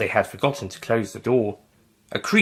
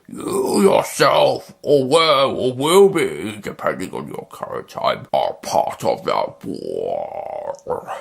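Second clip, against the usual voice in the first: ominous alien; much slower than usual. Acted. Squid Nestine voice.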